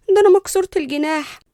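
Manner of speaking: high, poor-little-me plea; fake innocence. A narrator's reading. Cousin pleading she is helpless, falsely innocent. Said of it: "ببراءة مصطنعة".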